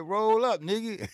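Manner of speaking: high, loud happy call to roll up; mock the neighbor's delight; eager invite. Neighbor hears about the chronic, high loud glee. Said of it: "He said".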